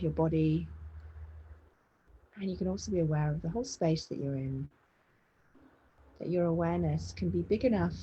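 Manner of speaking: measured meditation voice with long pauses; spacious, even-toned body scan guidance; guided meditation. Real, not acted. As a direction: guide them gently into body awareness, unhurried.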